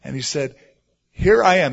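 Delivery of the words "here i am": loud, higher glad answer; acted joyful response; very happy. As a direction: call out happily in reply. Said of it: "he probably said it very happily".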